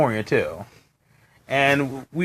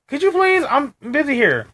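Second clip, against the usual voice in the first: exasperated retort; 10 semitones higher than usual. Retold or imitated. Retold irritated reply.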